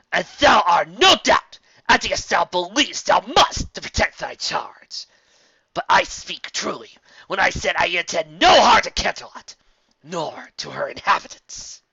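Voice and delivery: loud, high regal proclamation; forceful royal address. Acted. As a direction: declaim it like a goddess-queen to the guard. Celestia pleads forcefully to guard.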